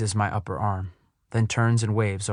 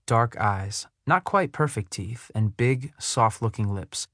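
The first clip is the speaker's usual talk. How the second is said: ordinary delivery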